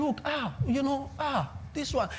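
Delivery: lightly voiced mock dither; mock hesitant believer. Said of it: "they are thinking".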